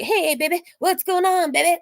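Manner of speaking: mock come-on, pitched high; imitated catcaller. Catcaller to women, high mocking imitation. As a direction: mimic his obnoxious pick-up line. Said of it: "that's how he talks to women".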